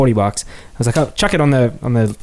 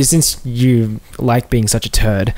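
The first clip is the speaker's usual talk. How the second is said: slow teasing jab; 4 semitones lower, much slower than usual. Real, not acted. Teasing friend slowly.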